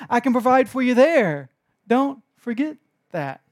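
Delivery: loud, high, insistent preaching; Jesus urging his frightened disciples, preaching loud. Acted. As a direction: plead with the crowd to trust. Voicing Jesus, preaching hard.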